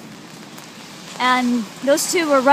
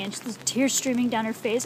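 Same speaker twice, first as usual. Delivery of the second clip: ordinary delivery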